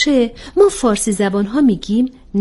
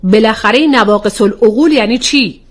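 louder, sharply put question; 5 dB louder than usual. Acted. Rahele challenges the troubling phrase about women's minds, sharply. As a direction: demand an explanation, sharply and loudly. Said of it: "با لحنی تند پرسید".

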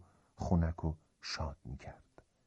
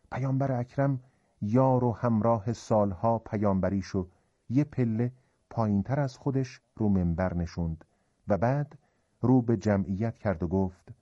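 usual speaking voice

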